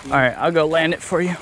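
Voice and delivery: ordinary delivery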